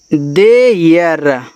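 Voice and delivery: There drawn out very slowly, each sound held; slow model pronunciation of there; slow demo. Shown as a demonstration. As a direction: stretch there out slowly for the class. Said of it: "ऐसे there".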